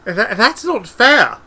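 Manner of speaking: high, loud, drawn-out whine; whining protest. A narrator's reading. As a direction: stammer and wail at the injustice. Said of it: "Now it's just a whine".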